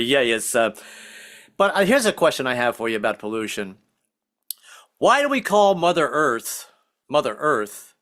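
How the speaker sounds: ordinary talk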